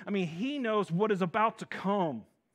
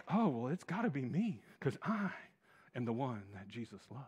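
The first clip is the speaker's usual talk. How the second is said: smug John; 6 semitones lower, 5 dB quieter than usual. Retold or imitated. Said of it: "John is like".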